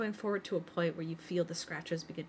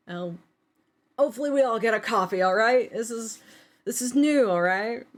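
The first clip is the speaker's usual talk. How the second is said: much louder, excited grandfather, fast and higher; a thrilled old man hurrying everyone; 4 semitones higher, 13 dB louder than usual. Acted. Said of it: "you hear him kind of go".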